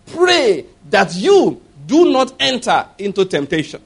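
slow solemn instruction. Retold or imitated. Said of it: "So Jesus told the disciples".

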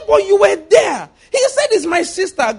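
high wailing protest; panicked plea. Acted. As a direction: cry out in alarm, defending yourself. Abimelech pleading.

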